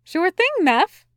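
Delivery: high, breezy, cheerful reply; chirpy and unbothered. A narrator's reading. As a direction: toss it off cheerily, pitch lifted. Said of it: "Sandalphon said cheerfully".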